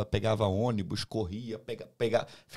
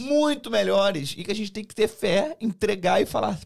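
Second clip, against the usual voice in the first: higher and louder, slow and emphatic at first; fervent preaching; 8 semitones higher, 7 dB louder than usual. Real, not acted. Urging the listeners to trust God, preaching fervently. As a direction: preach faith to the listeners with full conviction. Closing faith exhortation.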